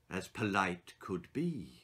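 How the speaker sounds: ordinary delivery, nothing marked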